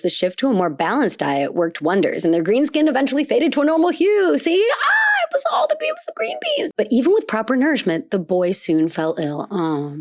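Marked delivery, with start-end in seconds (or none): 4.4-6.7 much higher, gleeful; high-pitched vindicated squeal; triumphant squeal. Real, not acted. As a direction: crow it like proof. Triumphant see, theory proven.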